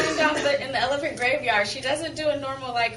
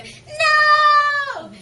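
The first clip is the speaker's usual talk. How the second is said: anguished shout, very high and loud; screaming no; 12 dB louder than usual. Retold or imitated. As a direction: scream No like the scene. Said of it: "doing the".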